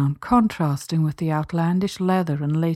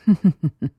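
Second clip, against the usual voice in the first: wicked laugh; 3 dB louder than usual. A narrator's reading. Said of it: "A wicked, sensual laugh".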